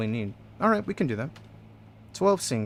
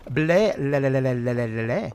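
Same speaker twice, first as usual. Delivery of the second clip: a silly babbled greeting of made-up syllables; a lightly voiced gibberish greeting; mock pineapple language. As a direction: babble a made-up tongue like a hearty hello. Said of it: "greet everybody in the language of the pineapple people".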